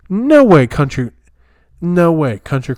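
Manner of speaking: loud, high no way; shocked outburst. Real, not acted. Learning his butter is a substitute, a loud shocked cry. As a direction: blurt out real shock.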